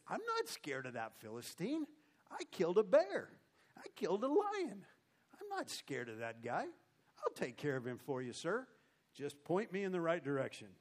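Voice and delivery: plucky acted boast with big pitch swings; cocky youngster. Scrawny young David boasting to the king, cocky. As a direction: brag like a fearless kid, punching the kills.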